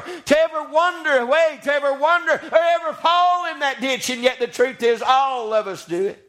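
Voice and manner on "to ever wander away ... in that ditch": high-pitched, rolling preacher's lament; full-force preaching. Acted. Sinner tells God of wandering away, preached hard. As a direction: preach the regret in a raised cadence.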